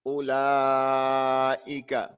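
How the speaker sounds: Ulaika with the alif stretched very long; tajweed demo of a stretched alif in Ulaika; drawn-out alif. Shown as a demonstration. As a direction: hold the long vowel in Ulaika, slowly. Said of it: "How is the Alif pronounced?".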